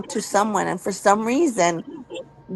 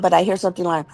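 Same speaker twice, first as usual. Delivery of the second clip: ordinary talk, nothing marked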